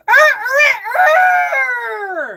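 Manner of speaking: playful growling animal noises; animal noise for the grandson. Retold or imitated. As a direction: growl like a toy animal for a toddler. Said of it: "And I'd go".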